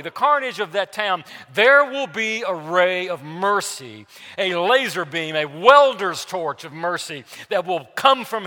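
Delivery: loud, driving sermon voice; fiery preaching. Acted. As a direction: preach it hard over the congregation. Preaching at full force.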